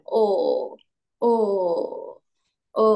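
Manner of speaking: o dropped low into the throat; deep third tone o. Shown as a demonstration. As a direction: pull each o down from the throat. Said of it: "keluar dari tenggorokan".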